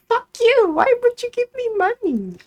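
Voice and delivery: high, loud sugary service voice; hammed-up fake politeness; mock sweet cashier. Cashier imitating what she didn't say to a customer, mock sweet. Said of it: "I wasn't like".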